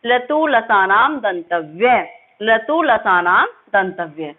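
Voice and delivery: लतु लसानाम दंतव्य slowly chanted with a rise on लतु; slow recitation. Shown as a demonstration. Teacher leading students to repeat the sutra, slow chant. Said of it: "बोलने की कोशिश कीजिए".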